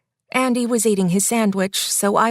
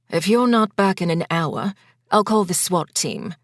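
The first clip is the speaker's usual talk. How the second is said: a lightly voiced husband, playfully teasing. A narrator's reading. Husband teases his wife before she visits the neighbor, playful. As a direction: rib her gently, half-joking. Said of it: "he teased".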